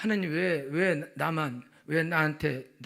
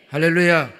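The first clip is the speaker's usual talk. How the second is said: shouted, ringing hallelujah; 할렐루야 shouted with a falling end; loud hallelujah; 8 dB louder than usual. Real, not acted. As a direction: call it out to the whole church. Loud call to congregation.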